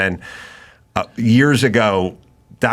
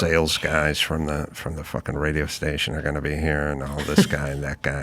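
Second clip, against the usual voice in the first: muttered worry; 8 semitones lower, 8 dB quieter, faster than usual. Retold or imitated. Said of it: "and you go".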